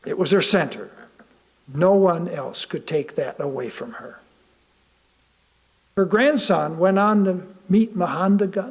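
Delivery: ordinary delivery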